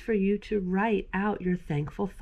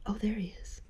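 hushed whisper, pointing out the mouse; 6 dB quieter, faster than usual. Real, not acted. Pointing out the hidden mouse to kids, whispered. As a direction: whisper so the mouse won't hear.

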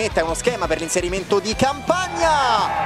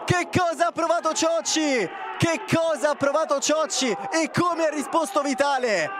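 excited commentary; 10 semitones higher than usual. Acted. Commentary clip, shouted questions.

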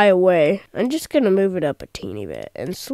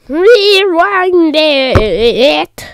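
very slow, louder, stretched-out rewind it; drawn-out command; 5 semitones higher, 7 dB louder, much slower than usual. Acted. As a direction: stretch each sound of Rewind it like a slow-motion order. Rewinding the simulation.

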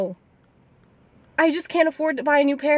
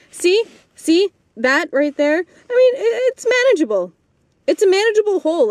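excited; 6 semitones higher, 5 dB louder than usual. Real, not acted. Showing hole, louder and higher.

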